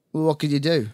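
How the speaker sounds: put-on short Irish-accented question from a bar owner; curt reply with a touch of Irish accent. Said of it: "she barked in an Irish accent".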